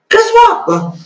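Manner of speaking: spring the gotcha on them; sarcastic guess what. Real, not acted. Sarcastic reveal of hypocrisy.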